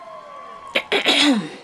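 a grand, pompous ahem; pompous throat clearing. Acted. Portly critic demanding attention, pompous. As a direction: clear your throat importantly. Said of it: "coughed pompously".

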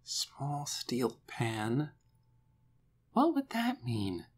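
slow, soft musing; murmuring to himself. A narrator's reading. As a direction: puzzle it out under your breath, slowly. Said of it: "He seemed to be talking to himself".

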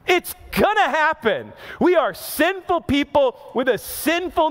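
high, driving sermon delivery with drawn-out key words; impassioned preaching. Real, not acted. Preacher to his congregation on taking a loss, impassioned preaching. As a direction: preach it hard, each question rising in intensity. Preaches the point at full force.

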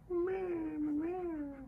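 high soft meow; playful meowing. Pretend. Mimicking a cat for the baby, high meow. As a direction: meow like a kitten.

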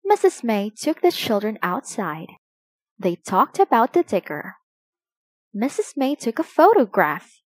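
usual speaking voice

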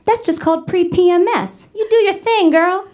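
put-on high-pitched hype-girl encouragement; supportive girlfriend app. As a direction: cheer her on like her best girlfriend. Voicing the app as sassy girlfriend.

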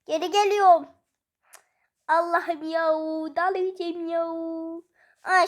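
usual speaking voice

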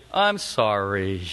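low, slow, heartfelt apology; mean it this time, from the heart; sincere sorry. Shown as a demonstration. Preacher showing a son's heartfelt apology to his brother. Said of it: "It's gotta be from the heart".

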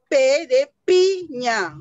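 P de piña, slow and loud; teacher slowing down to show "P de piña". Shown as a demonstration. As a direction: spell it out slowly for small children. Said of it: "Ya voy a hablar más despacito".

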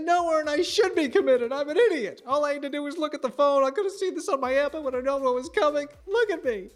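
high, loud self-mocking rant; soaked man ranting to neighbor, high and loud; exasperated rant. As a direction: berate yourself while running. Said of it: "I said".

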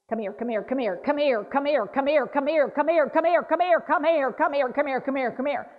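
mock repetitive calling, building and easing; echolalia demo. Shown as a demonstration. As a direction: keep calling come here, rising and falling back.

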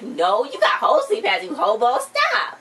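playful scolding that climbs high at the end; mock scolding. Mother telling off dad over holey pants, mock scolding. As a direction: scold with rising exasperation, pitch leaping on the last word.

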